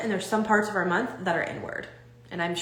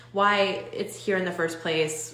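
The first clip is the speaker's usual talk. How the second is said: usual speaking voice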